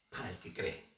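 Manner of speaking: hushed whisper; whispered answer to the congregation. Real, not acted. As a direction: drop to a whisper for emphasis. Preacher drops to a whisper.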